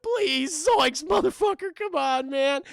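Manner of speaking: high, pleading cartoon whine; hammed-up exasperated pleading, pitched high; Shaggy. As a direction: beg like a scared goofy sidekick. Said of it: "Shaggy's like".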